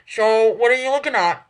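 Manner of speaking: talking through a mouthful of toast; words round a bite of food; mouth full. A narrator's reading. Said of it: "her mouth stuffed with toast".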